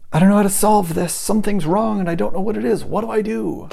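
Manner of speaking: imitating someone stuck and panicking; panicked. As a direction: act out being lost with a big problem. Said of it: "you have some big problem in front of you and you're like".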